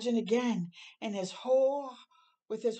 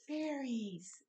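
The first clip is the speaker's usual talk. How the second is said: softer, hushed awe; 3 semitones higher, 8 dB quieter than usual. Acted. Olive hushing Hoot in the dark cellar, soft. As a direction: breathe the word softly. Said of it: "she whispered".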